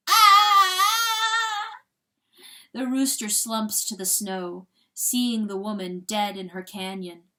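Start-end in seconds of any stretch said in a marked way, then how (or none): 0.1-1.8 long, high, loud wail; anguished scream. Acted. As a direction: scream out in torment. Rooster tormented by coyote's forgiveness.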